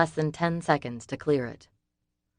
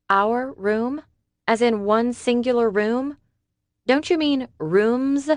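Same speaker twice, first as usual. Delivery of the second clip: higher, slow "rooms" with the S drawn out; stress on rooms; 8 semitones higher, 4 dB louder than usual. A narrator's reading. Summer to David, stressing the S of "rooms". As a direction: lean hard on the S of "rooms". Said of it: "She emphasized the S".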